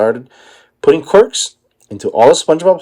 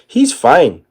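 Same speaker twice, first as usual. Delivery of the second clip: cried; 9 semitones higher than usual. Acted. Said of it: "cried Patrick".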